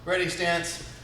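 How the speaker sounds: ordinary delivery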